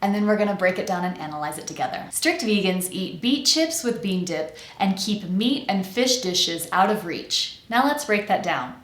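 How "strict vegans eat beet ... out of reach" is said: slow, carefully articulated tongue twister; tongue twister demo. Shown as a demonstration. Accent coach models the first tongue twister, slow careful demo. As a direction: say it slowly, every sound distinct. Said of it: "First, I'm going to say it".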